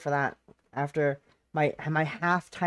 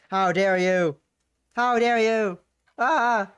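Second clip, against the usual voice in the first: mock outrage; 5 semitones higher, 9 dB louder, slower than usual.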